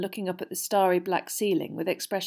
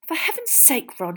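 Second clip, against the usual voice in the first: exasperated; 9 semitones higher, 4 dB louder than usual. A narrator's reading. Hermione dismisses Ron's excitement.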